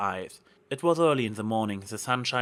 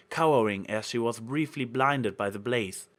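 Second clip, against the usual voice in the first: ordinary delivery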